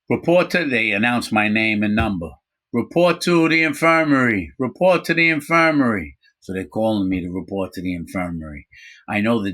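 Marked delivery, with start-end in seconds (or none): 0.1-0.6 high, loud announcer voice; mock PA announcement; loudspeaker call. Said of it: "I hear over the prison loudspeaker". 2.7-6.2 high, loud PA voice calling out; mock prison PA call; loudspeaker announcement. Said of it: "I hear over the prison loudspeaker".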